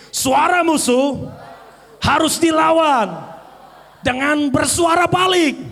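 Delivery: slow, shouted, every word pressed; loud declaration. Shown as a demonstration. Preacher shows the church how to say it loud. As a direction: proclaim it back at the enemy. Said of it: "ucapkan dengan keras".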